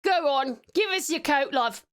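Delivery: playful chavvy British peasant; cheeky British commoner accent. Acted. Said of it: "in my peasant accent".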